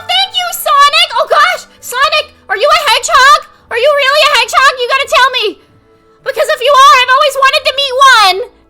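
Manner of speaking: high, loud, bubbly gushing; cute anime streamer. Real, not acted. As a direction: gush like an excited fan. Excited greeting to a viewer.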